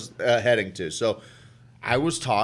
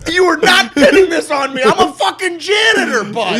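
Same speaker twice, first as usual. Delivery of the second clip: high, loud, panicked laughing yell; hammed-up yelling; 5 dB louder than usual. Nazi janitor denying blame at Nuremberg, high panicked yell. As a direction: shriek the excuses like a cornered janitor. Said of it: "they're just yelling".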